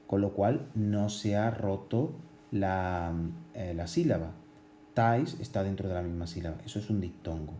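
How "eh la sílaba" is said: ordinary talk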